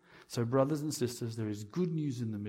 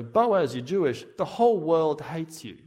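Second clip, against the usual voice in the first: loud mock taunt; 4 semitones higher, 7 dB louder than usual. Voices harsh taunt to Boaz.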